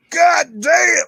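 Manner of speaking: high, loud God damn; big shouted exclamation; emphatic shout. Shown as a demonstration. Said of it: "You gotta say that with emphasis".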